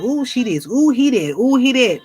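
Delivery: mocking gossip. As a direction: mimic petty gossipers, lightly. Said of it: "sit around and talk about".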